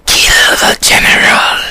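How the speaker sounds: slow, menacing whispered command; voice in the head. Acted. Imagined voice in his head urging murder, slow harsh whisper. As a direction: hiss it slowly like a sinister inner voice. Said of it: "if I had voices in my head".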